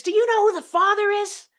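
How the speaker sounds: high, loud shriek; mom's shriek. Retold or imitated. Mother shrieking at her daughter over the phone. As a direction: shriek the question hysterically. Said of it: "my mom shrieks".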